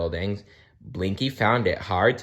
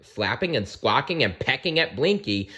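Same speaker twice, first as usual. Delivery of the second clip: ordinary delivery, nothing marked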